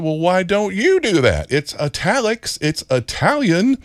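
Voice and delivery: high-pitched retold comeback, stretching the last words; mock-earnest schoolkid protest, pitched high; child's retort. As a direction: throw the question back at the teacher, high and insistent. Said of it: "And I looked at her and said".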